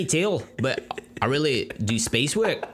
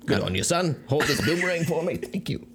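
hint of Australian; 5 semitones higher than usual. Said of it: "You kinda leaned into your Australian".

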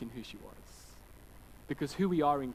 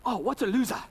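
put-on higher, louder child copying dad's jeer; parroting young son; 7 semitones higher, 7 dB louder than usual. Son copying his dad's road outburst, loud parroting. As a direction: imitate a kid gleefully echoing an outburst. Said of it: "my son starts going".

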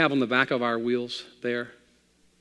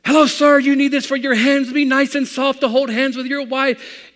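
put-on high, loud Italian salesman accent; 13 dB louder than usual. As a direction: coax the husband in an Italian accent. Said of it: "he had that kind of Italian accent".

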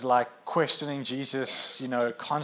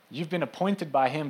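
ordinary delivery

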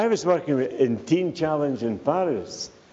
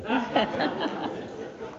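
breathy high laugh; 10 semitones higher, 6 dB quieter than usual. Real, not acted. Laughs after teasing wife.

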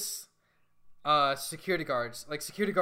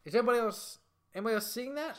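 higher-pitched, incredulous questioning; acted disbelief; 8 semitones higher, faster than usual. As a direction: play a bewildered patient checking with others. Acting out a bewildered patient's reaction.

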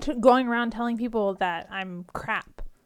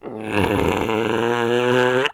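Chewbacca growl; 13 semitones lower, 5 dB louder than usual. Acted. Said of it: "You sound like a camel".

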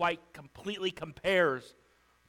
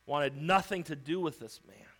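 usual speaking voice

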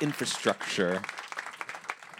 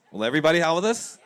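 fast, louder, higher rallying call; excited call; 8 semitones higher, 9 dB louder, much faster than usual. Real, not acted. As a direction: pump up the crowd. Host rallying the audience.

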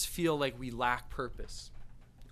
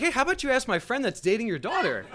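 mock protest; 8 semitones higher, 4 dB louder than usual. Guest deflects the challenge.

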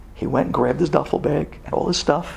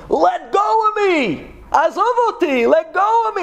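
acted screaming; 13 semitones higher than usual. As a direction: scream it high, imitating a man in agony. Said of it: "he's yelling and screaming".

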